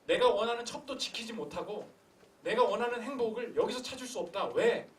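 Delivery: usual speaking voice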